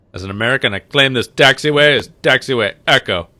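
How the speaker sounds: loud, hammed-up conquering declaration; mock proclamation. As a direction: proclaim it like a joking explorer planting a flag. Mock claiming taxiway as American.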